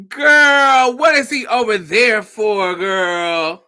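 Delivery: a friend shocked his date showed up; alarmed girl. Retold or imitated. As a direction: yelp it in alarm. Mario alarmed on phone.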